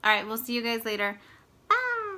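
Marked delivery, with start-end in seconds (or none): 1.7-2.2 bright high-pitched goodbye; high sing-song bye. Real, not acted. Sign-off wave.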